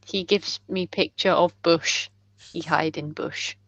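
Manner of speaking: low, blunt broken speech; simple telepathic character. Acted. Player as character relaying a pictured bush, low and simple. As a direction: drop the pitch and softly plod through it.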